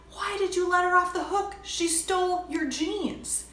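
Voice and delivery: high, indignant mock outrage; indignant friends. Friends scolding her for forgiving the thief, high indignant. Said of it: "They were like".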